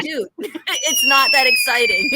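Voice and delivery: amused retold reproach, loud and slow; laughing mock protest. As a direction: drag it out through a laugh, half-pleading. Retold reply to screaming toddler.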